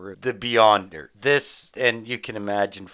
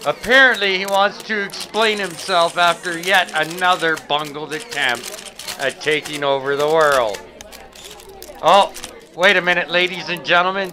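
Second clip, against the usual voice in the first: higher, louder breathless field reporter; news reporter; 7 semitones higher, 6 dB louder than usual. Acted. Reporter covering the villain's press conference, breathless. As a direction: report it live from the scene.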